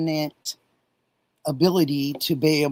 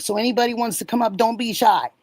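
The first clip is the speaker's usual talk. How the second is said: normal talking voice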